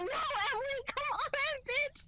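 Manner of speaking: high, urgent whine of protest; exasperated pleading. Retold or imitated. Pulling drunk friend off homeless man, exasperated pleading.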